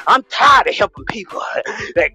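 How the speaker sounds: mock preaching. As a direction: whip the congregation up like a fired-up preacher.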